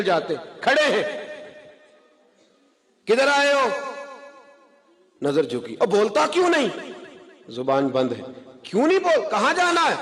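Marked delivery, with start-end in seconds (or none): 3.0-3.7 high, louder harsh demand from the uncle; angry uncle. Acted. Uncle confronts nephew. 5.8-6.7 angry uncle. Acted. Uncle confronts nephew. 8.6-9.9 angry uncle. Acted. Uncle confronts nephew.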